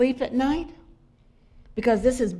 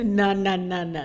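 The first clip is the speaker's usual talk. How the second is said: ordinary talk, nothing marked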